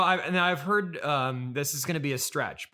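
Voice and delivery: ordinary delivery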